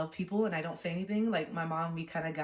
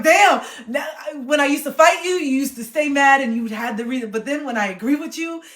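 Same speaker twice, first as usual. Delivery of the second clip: high, loud exclaiming; excited realization; 8 semitones higher, 13 dB louder than usual. Real, not acted. Vlogger exclaiming her insight to viewers, loud and high. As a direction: burst out with the discovery.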